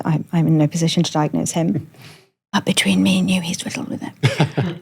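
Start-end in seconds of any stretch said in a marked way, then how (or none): 2.5-4.8 whispered confidence between friends; conspiratorial whisper. Real, not acted. To the host, a gossiping whisper about her brother.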